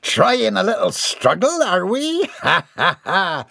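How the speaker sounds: high, slow, gloating pirate taunt; gruff pirate voice. Acted. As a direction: sneer at the captive like a pirate, then cackle. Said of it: "came Missus Dixon's voice again".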